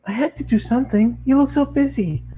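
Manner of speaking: pony explaining herself to her owner, very high. Acted. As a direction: chirp it like an eager cartoon pony. Pony character voice.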